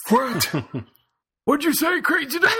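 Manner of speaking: put-on high cracked old man voice; doddering old geezer asking. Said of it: "as old as the old man voice".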